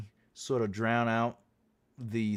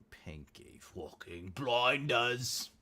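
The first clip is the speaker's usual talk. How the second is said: slow, softer Brummie impression; put-on Brummie accent; 5 dB quieter, much slower than usual. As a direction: draw it out in rough mock Brummie. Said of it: "the Brumman accent needs work".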